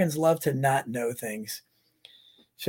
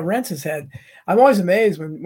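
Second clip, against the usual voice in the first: ordinary talk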